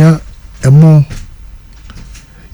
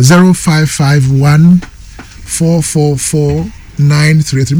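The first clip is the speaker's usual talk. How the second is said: slow dictation; much slower than usual. Acted. Host dictating phone number.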